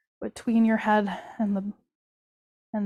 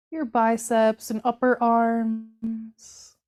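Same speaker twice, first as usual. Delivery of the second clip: guided body scan; 2 semitones lower, 3 dB quieter than usual. Acted. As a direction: guide them gently through the body, leaving room to feel. Continues the body scan meditation.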